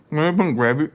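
talking through a mouthful of food; words round a bite; mouth full. Real, not acted. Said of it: "talking with my mouth full".

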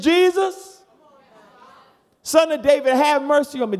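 high, loud desperate cry. Retold or imitated. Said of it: "He said".

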